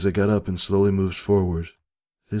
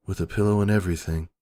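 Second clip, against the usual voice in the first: ordinary delivery, nothing marked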